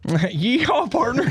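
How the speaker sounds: high cowboy southern whoop; mock southern accent. Ribbing friend over mistaken accent, mock southern whoop. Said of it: "gave them a southern accent".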